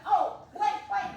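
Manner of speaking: hammed-up straining to remember; mock sudden recall.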